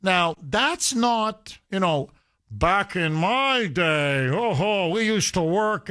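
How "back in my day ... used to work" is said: slow, drawn-out old-timer impression; hammed-up back-in-the-old-days voice; mock middle-aged fogey. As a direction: put on a nostalgic fogey voice, stretching the opening. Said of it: "this is not some middle-aged fogey".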